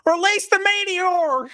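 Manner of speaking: put-on high hollering hillbilly accent. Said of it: "a hillbilly accent".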